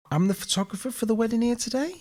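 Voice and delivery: nervous timid voice. Retold or imitated. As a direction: sound like you're bluffing and scared.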